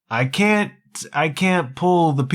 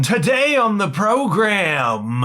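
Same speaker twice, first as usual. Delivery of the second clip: high, grand announcer opening; 8 semitones higher than usual. Acted. Host greeting the listeners in a big announcer voice. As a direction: open the show like a ringmaster.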